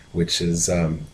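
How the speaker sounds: ordinary delivery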